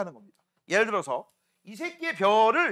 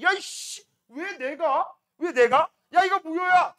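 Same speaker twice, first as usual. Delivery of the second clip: higher, louder sulky demand; indignant protest; 7 semitones higher, 7 dB louder, slower than usual. Acted. Jealous star insisting the choice is void, loud and indignant. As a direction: protest like a sore loser.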